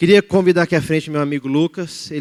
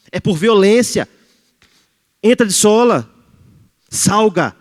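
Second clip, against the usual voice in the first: forceful preaching; 5 semitones higher, 7 dB louder, slower than usual. Real, not acted.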